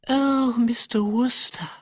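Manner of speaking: put-on high, breathless girlish gush, slowly savoured; gushing, dreamy young woman. Narrator voicing Madeline to Bertie in the park, high dreamy gush. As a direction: coo it like a sentimental girl.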